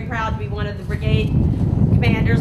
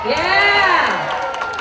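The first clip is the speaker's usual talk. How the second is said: cheering shout; 5 semitones higher, 7 dB louder than usual. Real, not acted. Rally crowd asked if ready.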